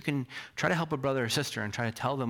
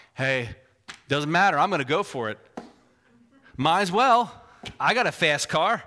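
lightly put-on Southern accent, loud; mock Southern driver, loudly brushing it off; 3 semitones higher, 5 dB louder than usual. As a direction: play a reckless driver with a hint of Southern twang. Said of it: "why I'm doing a Southern accent".

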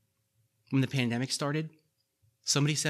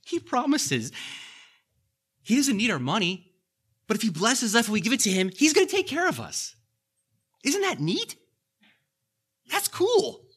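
excited; 10 semitones higher, 7 dB louder than usual. Acted. Excited high-pitched enthusiasm.

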